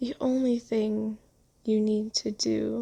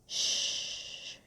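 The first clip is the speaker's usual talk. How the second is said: hushing; 8 dB quieter than usual. Acted. Soothing a crying listener.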